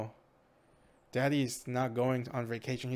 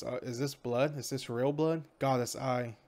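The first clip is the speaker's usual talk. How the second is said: ordinary delivery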